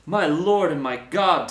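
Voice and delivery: slow, loud, awed exclamation; exclaiming. A narrator's reading. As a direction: cry it out, stunned with awe. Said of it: "Thomas exclaimed".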